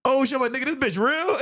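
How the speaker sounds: high-pitched mock astonishment; retold disbelief. Storyteller asking his homie if she's real, astonished. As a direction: blurt it out high, acting stunned.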